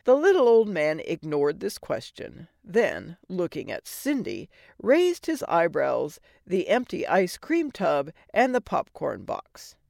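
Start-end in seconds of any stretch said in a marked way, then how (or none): none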